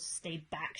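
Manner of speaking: ordinary delivery, nothing marked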